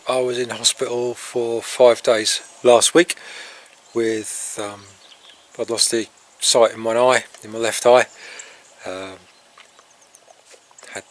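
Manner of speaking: ordinary talk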